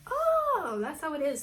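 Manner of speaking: high sarcastic oh of realization; a knowing jab at the fake friend. Narrator realizes friend is fake, sarcastic oh. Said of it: "I'm like".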